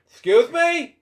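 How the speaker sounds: sassy indignant retort. Real, not acted. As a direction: act personally insulted by the line. Indignant reaction to story insult.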